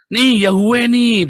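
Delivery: high, loud, drawn-out quote; loud mock "look here"; mock triumphant showing-off. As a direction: imitate someone triumphantly brandishing proof. Acts out opponents showing their manuscript.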